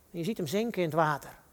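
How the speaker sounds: ordinary talk, nothing marked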